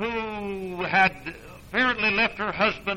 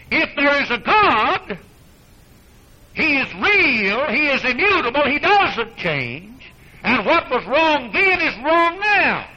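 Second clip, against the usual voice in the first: high, loud, slow emphatic preaching; preaching at full force; 8 semitones higher, 5 dB louder, slower than usual. Acted. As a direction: hammer each claim home.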